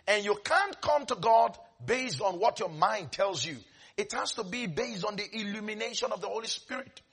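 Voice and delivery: ordinary talk, nothing marked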